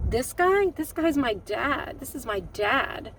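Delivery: real indignation at the doctor, dragged out slowly; stung daughter slowly defending her father; indignant outrage. Real, not acted.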